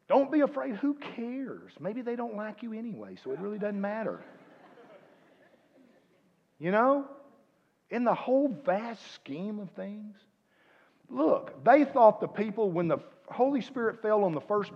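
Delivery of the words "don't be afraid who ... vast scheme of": high exhortation. Real, not acted.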